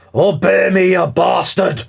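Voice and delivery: high, loud furious bellow; commanding shout. Acted. The dwarf lord yells at the chained giant to obey. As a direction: roar the command down at the beast. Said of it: "you can hear him".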